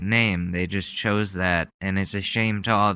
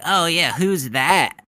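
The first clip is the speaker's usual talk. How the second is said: mock feigned ignorance; 7 semitones higher, 6 dB louder, slower than usual. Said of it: "You're just like".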